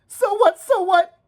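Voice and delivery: put-on a boy crying out in defiance. A narrator's reading. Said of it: "by this point I was crying".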